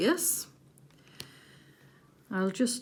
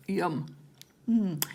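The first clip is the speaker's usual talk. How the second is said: ordinary talk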